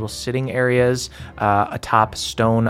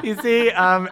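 ordinary delivery

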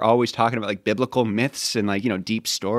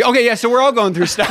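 higher, louder flustered backpedal; bailing out; 10 semitones higher, 5 dB louder than usual. Retold or imitated. Youth pastor trapped by his reading, bailing out loudly. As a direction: abandon the solemn moment in a hurry. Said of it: "had to bail on it".

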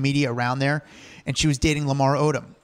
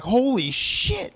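high, slow stunned "holy shit"; shocked exclamation; 9 semitones higher, much slower than usual. Retold or imitated. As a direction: gasp it out in disbelief. Retelling shocked reaction.

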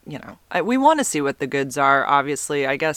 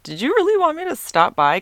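coy high question; 13 semitones higher, 4 dB louder than usual. Retold or imitated. Flirty question to fireman.

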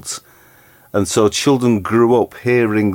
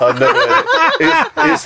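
high laughing disbelief; laughing surprise. Real, not acted. Host who never heard the word, laughing. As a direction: laugh it out, amazed.

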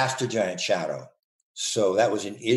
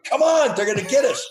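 high, loud fake alarm, urging others to flee; mock urgent panic; 12 semitones higher, 3 dB louder than usual. Running past the terrorists, urging them to flee: high, loud mock panic. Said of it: "run by the terrorists and go".

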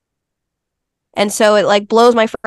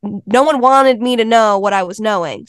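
ordinary talk

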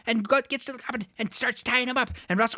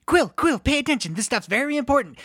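insistent scolding; 3 semitones higher, 3 dB louder than usual. Acted. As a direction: nag your scribe to listen up. Scolds his scribe.

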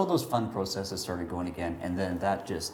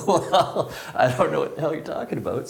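louder, words broken by laughter; laughing reply; 7 semitones higher, 5 dB louder than usual. Retold or imitated. Mark accepting the hyperventilation offer, laughing through it. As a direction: laugh through it, amused and baffled.

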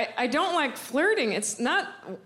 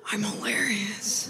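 whisper; 6 semitones lower, 3 dB quieter, slower than usual. Real, not acted. Self-aware aside whisper.

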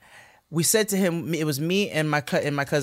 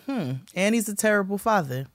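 knowing sarcasm; 5 semitones higher than usual. Retold or imitated. Sarcastic knowing list of faults.

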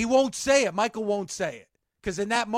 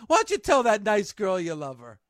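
usual speaking voice

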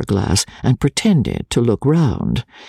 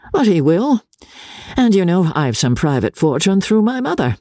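put-on high-pitched young gentleman's voice; self-assured, high character voice; confident young officer George; 9 semitones higher than usual.

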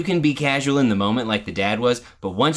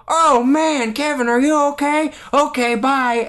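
high, louder mock-concerned family voice; mock breezy concern; 12 semitones higher, 4 dB louder than usual. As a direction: parody a quick check-in before dashing off. Mocks family's brief, casual concern.

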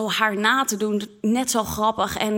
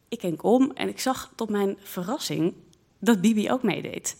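ordinary talk

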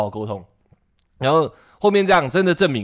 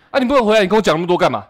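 mock rude manager; 6 semitones higher, 5 dB louder, much faster than usual. Quoting manager rudely, loud.

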